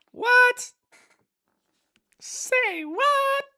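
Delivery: a sudden goofy high-pitched outburst; silly squeaky voice. Pretend. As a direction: jump way up into a cartoon squeal. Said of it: "I don't know why I said that".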